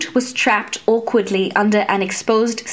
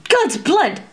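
startled curse as the boot is pierced; barked oath; 7 semitones higher than usual. A narrator's reading. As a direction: bark it out in pain and shock. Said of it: "Laéron barked".

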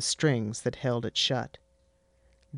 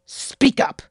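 sharp unvoiced hissed order; 9 dB louder than usual. Mother hissing at her mumbling son. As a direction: spit it at him. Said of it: "I hissed".

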